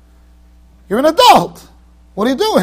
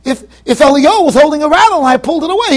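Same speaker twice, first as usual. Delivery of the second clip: usual speaking voice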